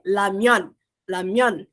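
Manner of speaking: "lamion" opened with a clear L; initial R as L. Shown as a demonstration. Teacher showing the rule for word-initial R, L in "lamion". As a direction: start "lamion" with the tongue-tip L, not R. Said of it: "é som de L".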